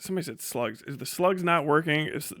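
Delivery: ordinary talk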